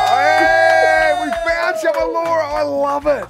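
high, loud burst of delight; excited shout. Real, not acted. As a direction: cheer like you just struck gold. Caller found on waterbed.

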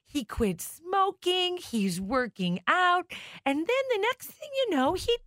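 lightly voiced mother, drawn-out sad tale; imitating mom. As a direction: lift it up like mom telling gossip, slowly.

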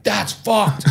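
high-pitched mock crowd reaction; crowd outrage. Crowd's reaction retold from stage.

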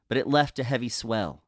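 plain reading voice

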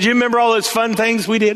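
dad hyping up a fun memory; coaxing dad. Retold or imitated. Dad building excitement with son.

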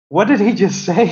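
high, astonished disbelief; stunned friends. Retold or imitated. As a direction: act out friends' amazement. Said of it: "my friends were like".

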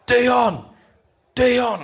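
high, loud call of a name. Retold or imitated. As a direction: call across the golf course. Someone calling his name on beach.

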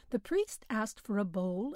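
ordinary delivery, nothing marked

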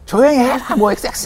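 mock a prisoner yelling from the next cell; retold shout, leaping up in pitch; imitated angry yell. Inmate swearing from next cell.

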